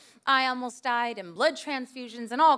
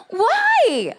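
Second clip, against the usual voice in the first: much higher, loud incredulous why; laughing shocked why; 11 semitones higher, 8 dB louder than usual. Retold or imitated. Wife reacting to the stranger's confession, in laughing disbelief. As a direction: blurt it out in disbelief. Shocked reply to slapping story.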